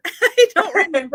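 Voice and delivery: giggling through the words; laughing. Real, not acted. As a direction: laugh it off lightly. Laughing at forgetting a viewer.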